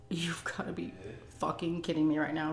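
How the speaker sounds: hushed, half-whispered disbelief; low stunned whisper; disbelieving whisper. Retold or imitated. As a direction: mutter it under your breath in shock. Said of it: "I was like".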